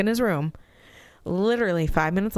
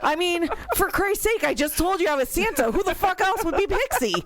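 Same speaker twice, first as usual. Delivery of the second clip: high, incredulous rant; mock outraged disbelief; exasperated; 13 semitones higher than usual. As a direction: throw up your hands, higher and higher. Exasperated retold outburst.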